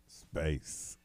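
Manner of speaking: deep voice. Pretend. Mock awe at NASA channel.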